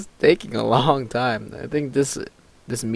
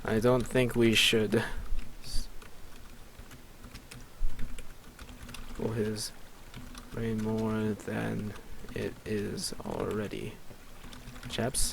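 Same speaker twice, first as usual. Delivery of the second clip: slow, soft, halting murmur; quiet, drawn-out words in broken chunks; composing aloud; 8 dB quieter, slower than usual. Real, not acted. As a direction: dictate it slowly to yourself, with long gaps. Composing the line while typing it.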